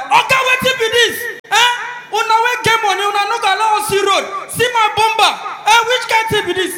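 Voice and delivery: loud, high protest at the rich man; angry complaint. Acted. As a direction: shout it up at him, outraged.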